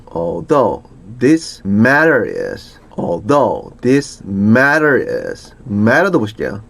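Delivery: ordinary talk, nothing marked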